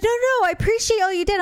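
high-pitched mock protest; placating husband. Wife imitating her husband after her complaint, high-pitched placating. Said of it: "He's like".

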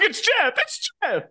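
high, squealing, excited gasp; starstruck fan. Retold or imitated. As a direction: gush like a fan seeing his idol. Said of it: "I'd be like".

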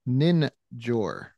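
Ninjor stretched out slowly, syllable by syllable; careful unhurried voice. Shown as a demonstration. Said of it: "And let me say this slowly".

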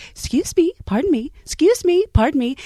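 high, prim and polite, excusing herself; overly polite. Retold or imitated. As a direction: mimic a woman who is far too polite while wrecking things. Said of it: "very polite".

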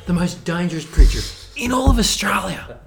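put-on hushed Australian accent; hushed Steve Irwin. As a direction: whisper like a croc hunter near the beast. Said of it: "I'm Steve Irwin".

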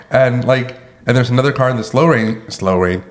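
ordinary talk, nothing marked

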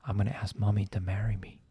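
low, hushed confiding murmur; retold secret whisper. Dad confiding his proposal plan to his son, hushed. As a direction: imitate letting a child in on a secret, very softly.

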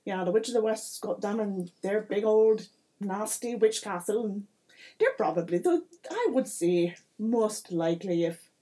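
animated mayor in a put-on accent; salesman-like mayor with a bad accent; chatty village mayor. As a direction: play a folksy mayor with a hammy accent, lively and rambling. Game master plays the mayor.

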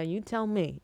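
ordinary delivery

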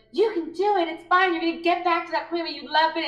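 high, cheerleading inner voice; encouraging old self. Retold or imitated. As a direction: imitate a relentlessly upbeat former self. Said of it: "old me in my head going like".

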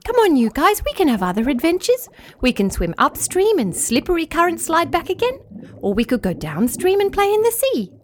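put-on young fish voice cheerfully urging; Flugia coaxing. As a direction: win the family over with other fun.

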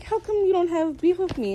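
a hint of hurt Ed; light Ed voice. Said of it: "And it's like".

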